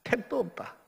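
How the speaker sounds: a touch of Gyeongsang accent; Gyeongsang dialect. Shown as a demonstration. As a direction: quote it lightly in Gyeongsang dialect. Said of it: "경상도 사투리로 하는 표현이 있잖아요".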